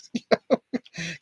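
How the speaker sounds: short breathy laugh, still in disbelief; wheezy chuckle of disbelief; disbelieving laugh. Real, not acted. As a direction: let a laugh slip out. Laughing after reaction.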